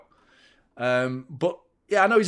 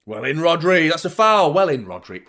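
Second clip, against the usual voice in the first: loud cheer for a tackle; excited shout; 2 semitones higher, 9 dB louder than usual. Real, not acted. Fan cheering Rodri's tackle, excited shout. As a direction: shout it out at the match.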